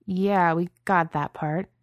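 slow, guarded, dry; slow wary reply to a surprise. A narrator's reading. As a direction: hold back, not sure yet. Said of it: "Justin said warily".

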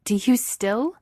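high, incredulous disgusted question. A narrator's reading. Ahsoka challenging Vader's belief in the lies, disgusted. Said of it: "Ahsoka says with disgust".

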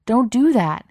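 high, loud urgent warning; alarmed shout. A narrator's reading. Stranger warns Cook to keep his hand back, alarmed shout. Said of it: "someone shouted".

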